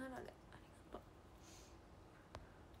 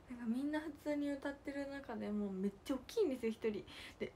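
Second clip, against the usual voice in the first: normal talking voice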